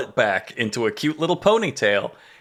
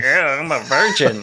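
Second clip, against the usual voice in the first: hammed-up nerd impression; put-on dorky voice. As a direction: play a mocking caricature of a nerd. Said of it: "put on the nerd cosplay and be like".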